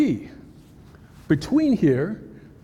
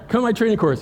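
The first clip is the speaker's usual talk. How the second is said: louder, higher pitch for the plug; sales pitch; 5 semitones higher, 3 dB louder, faster than usual. Shown as a demonstration. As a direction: sell the course with a wink. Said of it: "See, Darren, how I put that in there?".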